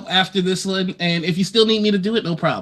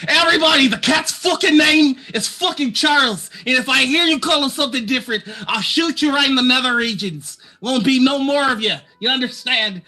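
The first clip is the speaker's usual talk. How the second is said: Lux yelling; 7 semitones higher than usual. Acted. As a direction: bark the threat like a small angry gunslinger. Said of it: "so she yells that".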